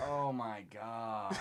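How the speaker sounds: slow, low, hushed groan of words; quiet despair. Real, not acted. Host watching the show fall apart, quiet despair. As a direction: let it sink out softly, defeated.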